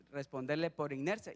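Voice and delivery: ordinary talk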